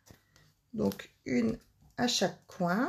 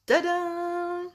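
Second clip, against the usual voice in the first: high, drawn-out ta-da; high held fanfare note; triumphant reveal; 9 semitones higher than usual. Real, not acted. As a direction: sing it out as a proud reveal. Revealing the finished card.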